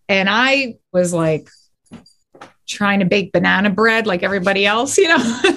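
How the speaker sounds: ordinary talk, nothing marked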